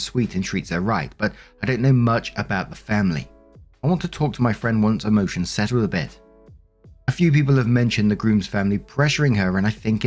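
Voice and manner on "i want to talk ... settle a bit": ordinary delivery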